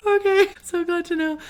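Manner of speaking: high, loud, strained-cheerful reply; mock-polite high-pitched acknowledgement; acted uneasy politeness. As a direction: chirp it way up high to cover unease. Acted uneasy reply to ghost story.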